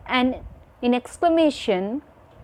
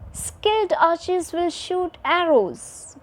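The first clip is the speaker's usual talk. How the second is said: high-pitched opening on "skilled", dropping to low at "arrows"; statement tune, high to low; 6 semitones higher than usual. Shown as a demonstration. Teacher models a sentence for children, high-to-low tune from "skilled" to "arrows". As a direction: start high on "skilled" and fall away on "arrows". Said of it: "our voice goes from high to low".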